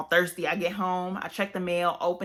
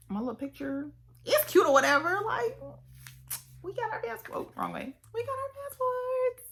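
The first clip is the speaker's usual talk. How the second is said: giddy. Real, not acted. Giddy high voice over passports.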